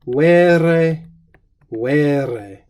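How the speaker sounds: "Vere, vere" as a strong doubled affirmation; emphatic repetition. Shown as a demonstration. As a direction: repeat "Vere, vere" for emphasis, leaning on the first one. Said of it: "with emphatic repetition there".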